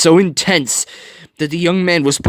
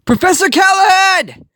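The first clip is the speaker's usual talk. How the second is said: much higher, loud call across a burning hall; 5 dB louder than usual. A narrator's reading. Tadashi calls for his professor inside the burning hall, loud call. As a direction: call out into the smoke. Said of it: "Tadashi called".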